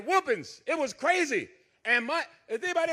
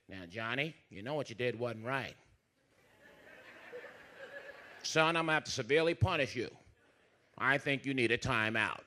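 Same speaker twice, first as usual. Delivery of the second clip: much lower, softer patient father; a mild, soft-spoken dad coaxing his son; calm dad voice; 10 semitones lower, 7 dB quieter than usual. Retold or imitated. Father gently scolding son.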